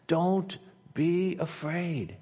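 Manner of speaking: soft, slow comforting promise; gentle reassurance. Acted. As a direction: hush a scared servant with calm. Pastor reading God's comfort aloud.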